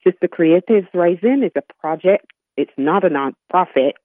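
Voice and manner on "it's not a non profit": usual speaking voice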